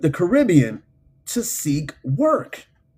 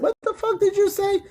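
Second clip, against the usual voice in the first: very high-pitched disbelief; incredulous. Retold or imitated. Retelling his reply to James's odd word, high incredulous squeal. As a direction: squeak it out in mock shock.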